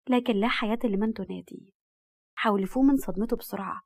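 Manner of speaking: ordinary delivery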